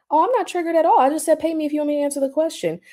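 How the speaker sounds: high, fast, sarcastic fake innocence; mocking bot voice. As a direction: mimic a smug bot saying it isn't bothered. Mocking a bot's reply.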